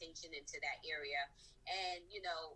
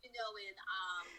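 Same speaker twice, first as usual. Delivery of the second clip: usual speaking voice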